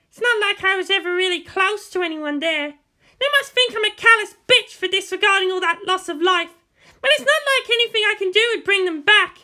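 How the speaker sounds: put-on proud girl refusing to be weak; defiant teenage girl. Pilot girl telling herself she must be strong, defiant.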